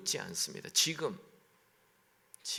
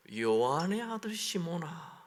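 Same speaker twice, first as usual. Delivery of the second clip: a touch higher, heavy stress on 아들 in 요한의 아들 시몬아; ominous call; 5 semitones higher than usual. Retold or imitated. Preacher voicing Jesus calling Peter, a mildly ominous call. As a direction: call him by his old name with weight. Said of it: "이 약간 좀 분위기가 안 좋습니다".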